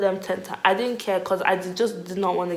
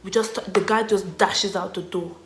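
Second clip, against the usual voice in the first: ordinary talk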